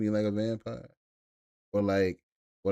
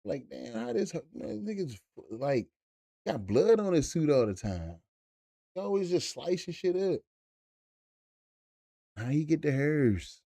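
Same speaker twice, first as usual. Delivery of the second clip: high, incredulous mocking questions; a hint of puzzled rivals; mock confused high voices; bewildered onlookers; 5 semitones higher than usual. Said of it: "They be like".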